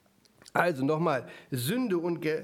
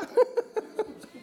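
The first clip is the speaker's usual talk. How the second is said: squealing laugh. Retold or imitated.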